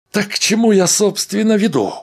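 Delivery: husband suddenly raising his voice. A narrator's reading. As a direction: snap the question out loud. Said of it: "Резко повысил голос Дональдсон".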